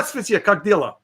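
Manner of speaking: Russian. Real, not acted. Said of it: "That's my mother's Russian side".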